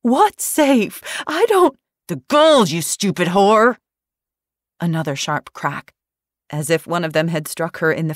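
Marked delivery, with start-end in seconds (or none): 0.0-1.8 very high, loud frantic disbelief; panicked confusion. Acted. Daphne panicked, cut off. 2.1-3.8 loud, higher snarled insult; furious thug. Acted.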